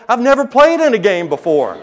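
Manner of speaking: laughing excitedly. Retold or imitated.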